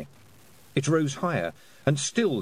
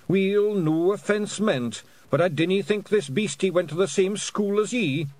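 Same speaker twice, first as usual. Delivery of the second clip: politely doubt her in raised broad Scots; Daft Woolly; 8 semitones higher than usual. A narrator's reading. Pictsie doubting girl's lesson on whales, high Scots.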